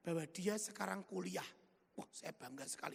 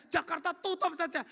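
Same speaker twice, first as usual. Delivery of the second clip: louder, harder taunt on tutup; 7 semitones higher, 8 dB louder than usual. Retold or imitated. Devil pushing the preacher to shut Jakarta, louder.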